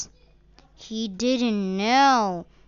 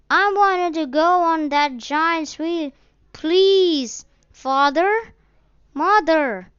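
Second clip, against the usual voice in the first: put-on high, louder child's earnest plea; little boy begging to ride the giant wheel; pleading child; 9 semitones higher, 7 dB louder than usual. A narrator's reading. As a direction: beg your parents with all your heart. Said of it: "the child pleaded earnestly".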